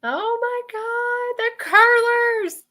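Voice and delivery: high drawn-out squeal of recognition; delighted exclamation. Real, not acted. Host reacting to the detail of the curlers, delighted exclamation.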